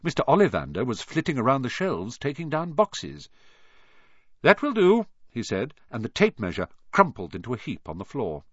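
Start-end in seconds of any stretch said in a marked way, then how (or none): 4.4-5.1 high, loud order; raised-voice dismissal; brisk command. A narrator's reading. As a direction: call a halt crisply. Wandmaker orders the tape to stop.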